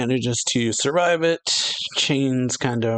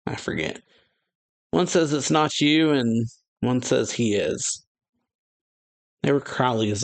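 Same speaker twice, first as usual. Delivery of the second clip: normal talking voice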